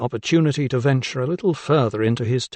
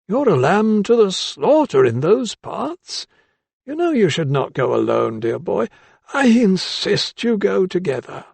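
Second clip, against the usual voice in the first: put-on old doctor; 8 semitones higher than usual. A narrator's reading. Old doctor voiced in story.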